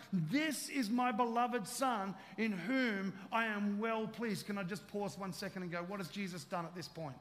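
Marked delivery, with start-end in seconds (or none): 0.1-4.5 slow declaration. Shown as a demonstration. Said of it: "turn to each other and say".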